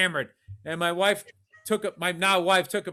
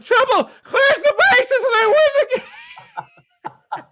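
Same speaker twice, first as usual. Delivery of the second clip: high, loud announcer scream; imitating an announcer yelling the winning hit; excited announcer yell; 7 dB louder, slower than usual. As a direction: lose it on the call like a play-by-play man. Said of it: "announcer finally erupts".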